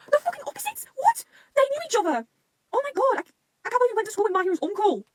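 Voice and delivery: very high, squeaky incredulity; stunned disbelief. Real, not acted. Fan learning of the school connection, stunned. Shocked by the uncle reveal.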